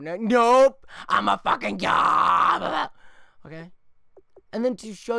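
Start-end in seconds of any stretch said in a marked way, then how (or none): 0.0-2.9 huge slow bellowing brag; triumphant shout. Acted. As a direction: roar like a god who caught it. Gloating as the player catches it.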